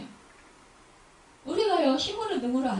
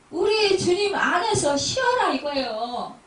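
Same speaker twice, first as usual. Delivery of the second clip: slow, loud pleading sermon; slow urgent preaching; 6 dB louder, slower than usual. Acted. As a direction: lean on every word, slowly. (느리고 힘주어 외치는 설교)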